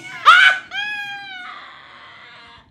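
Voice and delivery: high loud screaming cackle; loud shrieking laugh. Acted. Madam on the phone shrieking with laughter.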